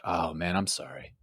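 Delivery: low, hushed sympathy; pitying. Retold or imitated. Rental clerk commiserating with an American customer, hushed and pitying. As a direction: drop the voice to a soft, sorry murmur. Acts out expat's pitying reply.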